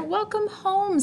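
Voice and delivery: high, sing-song welcome home; a bright, lilting greeting; cheery welcome. Acted. Father greets his daughter home, bright and high.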